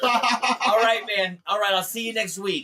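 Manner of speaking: loud, high phone sign-off. Retold or imitated. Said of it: "and [name] goes".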